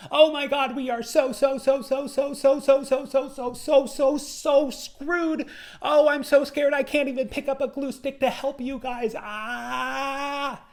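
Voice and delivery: a groupmate in total meltdown, squealing; over-the-top mock hysteria ending in a shriek; hysterical panic. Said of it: "one of the people in the group was saying".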